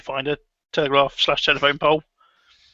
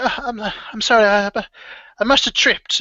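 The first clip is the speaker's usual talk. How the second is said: put-on high, fast, apologetic old woman; frail injured old lady; 5 semitones higher than usual. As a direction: brush off the pain politely.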